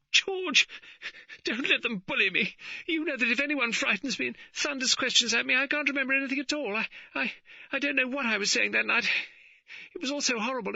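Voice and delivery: high, tearful pleading; sobbing plea. A narrator's reading. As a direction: beg her husband through tears. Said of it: "She sobbed".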